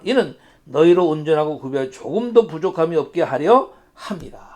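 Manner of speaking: solemn measured Bible reading; scripture reading. Acted. Pastor reading James to the congregation, solemn. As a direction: read the verses out to the church.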